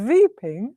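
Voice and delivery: "weeping" said as "veeping"; German V for W. Retold or imitated. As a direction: draw out "weeping" with a V at the start. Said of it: "from viping, wiping, to weeping".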